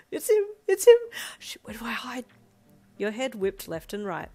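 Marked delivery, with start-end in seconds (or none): 0.1-0.4 high excited cry, still held back; panicked squeal. Acted. Hiding upstairs as Darby arrives, first panicked squeal. 0.7-1.0 very high, loud thrilled scream; panicked shriek. Acted. Hiding upstairs as Darby arrives, loud panicked shriek. 1.4-2.3 urgent hushed whisper; panicked whisper. Acted. Hiding from Darby in a strange room, frantic whisper.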